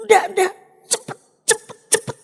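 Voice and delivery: high, rushed urging to hurry; doctor pressing the mother to hurry up; urgent doctor. Retold or imitated. A doctor urging a mother to rush her baby to hospital, urgent and high. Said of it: "dokter ini ndak pernah ngomong sedemikian rupa".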